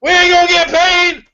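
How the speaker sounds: outraged shout. Pretend. Angry at losing his pay.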